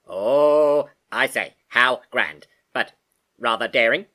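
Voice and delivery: a puzzled, posh machine; puzzled robot. Acted. Computer questioning its new name, puzzled. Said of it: "in a puzzled tone".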